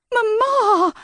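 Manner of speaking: high, loud anguished wail for her mother. A narrator's reading. Daughter wails for her mother at full volume. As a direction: cry out for Mamma at the top of your lungs. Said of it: "wailed at the top of her lungs".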